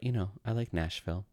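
ordinary delivery

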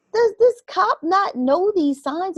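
high, slow question of disbelief; incredulous. Retold or imitated. Retold disbelief at the officer's inaction.

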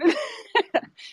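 a quick burst of real laughter; amused little laugh; short laugh. Real, not acted. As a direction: laugh at the thought. Laughs about chasing her down.

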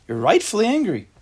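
high, loud indignant outburst; mock self-righteous anger. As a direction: shout it like someone sure they're in the right. Voices the angry person's justification.

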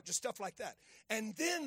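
normal talking voice

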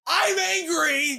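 high, slow, drawn-out mock-angry cry; hammed-up angry announcement. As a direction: declare fake outrage at a high pitch. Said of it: "you sound like a friendly monster".

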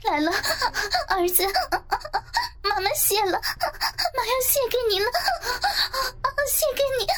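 very high cries rising to a wail; piercing drawn-out cry. A narrator's reading. Narrator voicing the mother's climax, piercing long cries. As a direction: climb into long, sharp, breathless cries. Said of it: "伴随着我尖利的长音".

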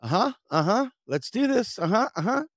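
high, squeaky fake-brave chant; faking bravery. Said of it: "And I'm terrified".

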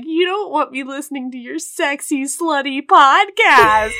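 high teasing mom imitation; making fun. Host imitating her mom making fun of her, teasing. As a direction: stretch out the last words to mock. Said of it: "she's, like, making fun of me".

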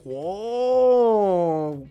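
one long, swelling, loud wow; amazed wow. Real, not acted. Host reacting to a listener's boxing comparison, amazed long wow. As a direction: stretch out the astonishment.

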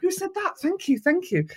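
high, surprised and flattered; pleased celebrity looking round; imitating a flattered star; delighted star. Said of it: "And they'll go".

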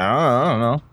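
put-on low, mumbled little-kid answer, trailing off; mumbling kid. Retelling the girl dodging the lady's question, a mumbled kid voice. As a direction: mumble it like a cornered child.

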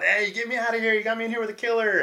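joking holler. Retold or imitated. In a cell with a killer, a mock holler to the guards. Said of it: "holler out".

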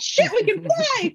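high, thrilled squeal; high gleeful shout from a winged horse; excited horses. Retold or imitated. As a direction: squeal it like a delighted flying horse. Horses voiced flying, high.

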